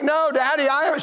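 put-on high little-kid voice; little daughter. Four-year-old denying it to daddy, small child voice. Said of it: "She's four or five years old".

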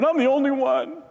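mock woe-is-me, pitched high; self-pitying Elijah. Elijah feeling sorry for himself in the cave, exaggerated self-pity. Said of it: "Elijah's feeling sorry for himself".

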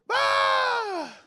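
high mock newborn wail; imitated baby cry. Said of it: "cómo lloran los niños".

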